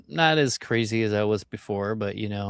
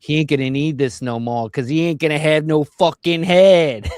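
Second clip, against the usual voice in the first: put-on tough-guy Italian accent from Long Island, louder; 5 semitones higher, 7 dB louder than usual. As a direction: mobster in an Italian accent threatening a kid. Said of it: "funnier to say it in that voice".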